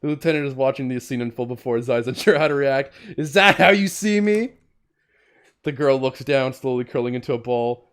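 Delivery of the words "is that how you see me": high, loud acted challenge; wounded outburst. Cop confronting the shaken girl, loud wounded outburst. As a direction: throw the question out in hurt indignation.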